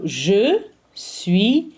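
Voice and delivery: "je suis" drawn out very slowly for copying; slow model phrase. Shown as a demonstration. Teacher modelling the conjugation for the class, very slow. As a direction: spell "je suis" out slowly, word by word. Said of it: "models the conjugation for students".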